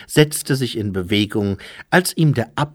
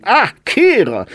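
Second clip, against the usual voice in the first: high, loud acted monk's voice; exclaimed greeting, pitched well up; Rinpo greeting the sweeper; 12 semitones higher, 7 dB louder than usual. A narrator's reading.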